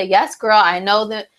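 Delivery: ordinary talk, nothing marked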